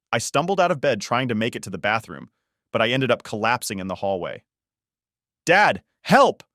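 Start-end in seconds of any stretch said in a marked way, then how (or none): 5.4-6.4 loud cry for help in pain; shouting for help. Acted. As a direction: yell out for Dad. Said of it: "I shouted".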